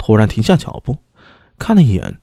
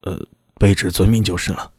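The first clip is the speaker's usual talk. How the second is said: reluctant and glum; 5 semitones lower, 4 dB quieter than usual. A narrator's reading. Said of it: "苦着脸说道".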